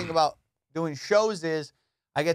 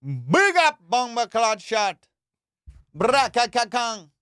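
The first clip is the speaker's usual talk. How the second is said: much higher, louder, slowed Jamaican toasting voice; Jamaican accent; 7 semitones higher, 5 dB louder, slower than usual. Pretend. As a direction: chant like a dancehall DJ in a Jamaican accent. Jamaican impression, chanting.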